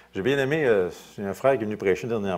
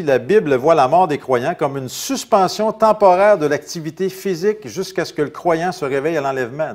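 quoted reading; 6 semitones higher, 9 dB louder than usual. Acted. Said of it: "Arnold Fruchtenbaum explique ceci".

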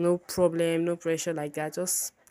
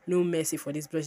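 ordinary talk, nothing marked

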